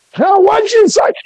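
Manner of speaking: put-on loud, high "what'd you say" of a deaf old man; hard-of-hearing man. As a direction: yell it like he can't hear a thing. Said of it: "one of them big things that you put on your ear".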